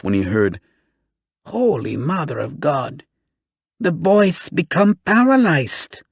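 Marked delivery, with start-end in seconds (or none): 1.4-6.0 high shrill lisp, slow and mocking. A narrator's reading. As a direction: squeak it out with a lisp. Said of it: "in that shrill, lisping voice of his".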